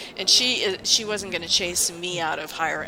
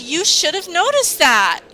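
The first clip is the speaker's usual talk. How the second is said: much higher, louder accusing line; imitating boss's reproach; 10 semitones higher, 9 dB louder than usual. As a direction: mimic her snippy reproach, pitched high and loud. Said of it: "that's how she would say it".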